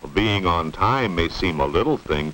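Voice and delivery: ordinary talk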